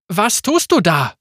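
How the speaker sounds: sharp, startled question; alarmed. Acted. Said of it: "fragte Riven alarmiert".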